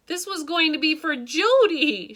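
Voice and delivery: ordinary delivery, nothing marked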